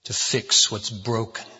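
hushed, low prayer voice; soft, humble pastoral prayer. Real, not acted. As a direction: pray quietly and reverently before preaching.

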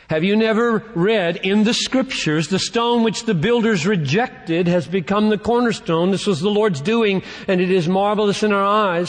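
high-pitched, declamatory Bible reading; Scripture proclamation. Acted. Preacher reads prophecy to the congregation, proclaiming in a high pitch.

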